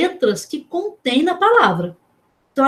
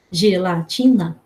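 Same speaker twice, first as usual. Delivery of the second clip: "Gelatina" slow and lower, each syllable distinct; 5 semitones lower, slower than usual. Shown as a demonstration. Teacher proving there are four syllables, "Gelatina" syllable by syllable. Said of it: "gelatina tem quatro sílabas".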